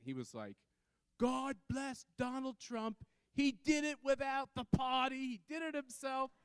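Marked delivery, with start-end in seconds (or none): 1.2-6.3 high, slow, put-on New York accent; hammed-up New York accent, pitched way up. Hard-hat construction worker cheering outside Trump Tower, thick New York accent. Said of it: "in his best New York accent".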